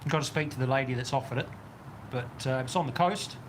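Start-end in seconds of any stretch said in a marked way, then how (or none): none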